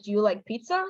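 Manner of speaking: PIZZA rises at the end; American yes-no question, lifting on "pizza"; rising tone. Shown as a demonstration. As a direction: let "pizza" climb into a question. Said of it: "will end with a rising tone".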